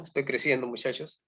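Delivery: talking through the hiccups; hiccuping. Real, not acted. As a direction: hiccups between words. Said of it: "Uy, me dio hipo".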